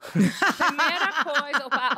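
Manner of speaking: laughing through the punchline; giggling while telling the joke. Real, not acted. Piada rindo sobre o patriarcado. (rindo enquanto fala)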